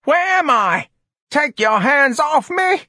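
very high, loud shriek; frantic old woman. A narrator's reading. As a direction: shout in alarm to fend them off. Startled old woman fends off others.

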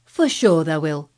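firm, loud Yorkshire lad; stout Yorkshire reassurance. Acted. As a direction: reassure him firmly, Yorkshire. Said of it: "he said stoutly".

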